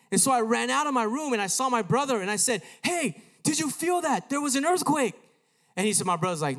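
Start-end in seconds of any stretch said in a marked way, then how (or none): 2.8-5.1 high, loud retold alarm; alarmed calling. Boy running out to his brother, alarmed. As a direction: call out urgently in a raised pitch.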